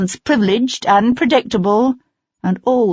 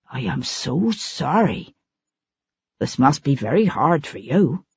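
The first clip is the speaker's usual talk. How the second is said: mock sympathy; 4 semitones lower, 4 dB quieter than usual. Feigned sympathy.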